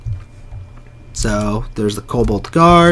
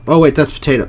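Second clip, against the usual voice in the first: ordinary delivery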